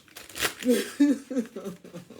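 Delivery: high burst of laughter; loud, high giggle; sheepish laugh. Real, not acted. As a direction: laugh at yourself. Laughing after the rant.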